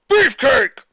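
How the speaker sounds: loud high battle cry; loud defiant yell; scream. Acted. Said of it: "Demikins screamed".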